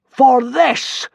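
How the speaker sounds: loud, high, solemn god voice; solemn boom. A narrator's reading. Hiccup as a god solemnly booming at the consul. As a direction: pronounce it gravely. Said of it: "boomed Hiccup solemnly".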